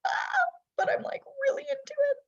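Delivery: high, fast, giddy squeal of fear and delight; an excited shriek rising into a rushed gush; terrified but thrilled. Retold or imitated. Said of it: "You're like".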